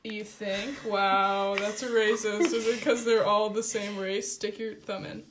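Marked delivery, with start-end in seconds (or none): none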